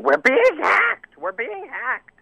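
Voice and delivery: very high-pitched mock-panicked cry; frantic panic. Son imitating his mom's alarmed text about being hacked, frantic. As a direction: imitate a panicked mom, voice shooting way up. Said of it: "sends me this frantic text message".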